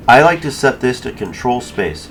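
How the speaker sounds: ordinary talk, nothing marked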